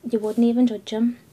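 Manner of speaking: ordinary talk, nothing marked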